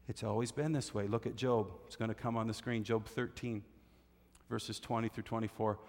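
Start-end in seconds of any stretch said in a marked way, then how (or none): none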